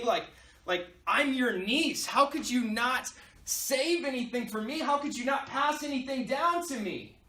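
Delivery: mock-upset complaint, rising in pitch toward the end; upset niece. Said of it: "is so upset".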